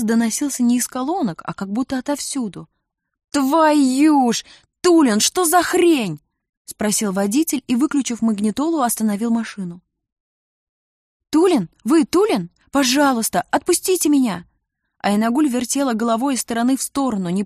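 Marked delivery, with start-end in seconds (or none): 3.3-6.2 loud, high, drawn-out swearing; startled outburst. A narrator's reading. Driver demands to know about strange singing, startled shout. 11.3-14.5 high, agitated questions turning into a plea; urgent pleading. A narrator's reading. Captive woman appeals to Tulin for release, high urgent pleading. Captive recognises name, begs again.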